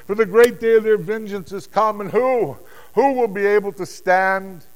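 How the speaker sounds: high, sustained shouting; loud, pleading wail of words; desperate crying out. Acted. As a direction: cry out to the mountains in dread. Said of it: "they cried out to the mountains".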